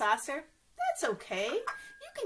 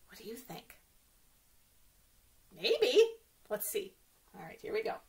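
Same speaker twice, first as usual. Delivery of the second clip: ordinary delivery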